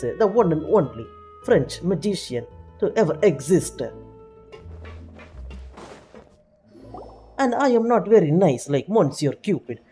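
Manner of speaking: mock-offended French accent; boastful magician with a heavy French accent. Magician scolding a viewer for insulting him, French accent. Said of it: "do not mistreat the Frenchman accent".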